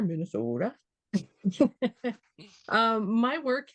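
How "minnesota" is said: "Minnesota" in a Minnesota accent with a pure, rounded long o in the middle. Shown as a demonstration. Artist shows the gallery audience her Minnesota accent. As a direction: play up the hometown Minnesota accent on "Minnesota". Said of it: "with my best accent on there".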